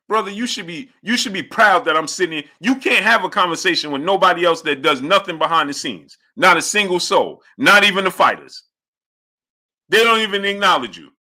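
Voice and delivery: high-pitched, heated protest; indignant lecture. Real, not acted. Streamer lecturing an ungrateful viewer, heated and high. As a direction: put the critic in their place.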